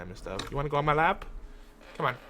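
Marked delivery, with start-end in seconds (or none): none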